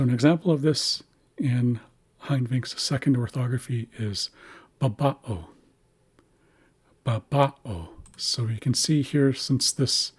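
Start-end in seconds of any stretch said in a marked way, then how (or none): 4.8-5.5 "babao" with emphasis on the middle "ba"; second-syllable stress. Shown as a demonstration. Teacher giving an example word, "babao" stressed on the middle syllable. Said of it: "we're placing emphasis on this second syllable". 7.0-7.9 "Babao" with the middle "ba" emphasized; second-syllable stress. Shown as a demonstration. Teacher repeating the example, "Babao" stressed in the middle. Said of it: "we're placing emphasis on this second syllable".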